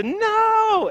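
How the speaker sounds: put-on high, loud wailing no like a frustrated kid; a son's loud, high-pitched protest cry; child's crying no. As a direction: cry out no as a tickled child who's had enough. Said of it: "this weird cry".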